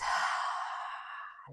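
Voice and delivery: long audible sigh out; deep sigh. Shown as a demonstration. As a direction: breathe out in a big sigh. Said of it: "a big sigh to release".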